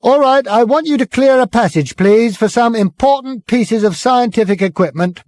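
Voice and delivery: high, loud booming announcement. Acted. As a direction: boom it over the crowd. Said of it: "boomed Ford".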